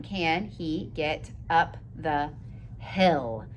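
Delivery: quiet and drawn out, word by word; slow dictation. Shown as a demonstration. Teacher rereading the sentence slowly for young writers. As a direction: read it slowly as you write. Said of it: "Slow down and take it slow".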